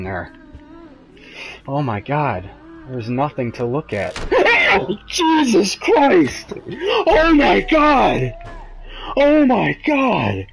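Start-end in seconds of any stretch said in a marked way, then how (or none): 4.2-5.0 sudden very high shriek of shock; shrieking scream-laugh. Real, not acted. Streamer hit by a ghost jump scare, shrieking scream-laugh. 5.1-10.5 very high, louder, slow panicked shouts; terrified screaming. Real, not acted. Streamer to viewers after a ghost jumps out, terrified screaming.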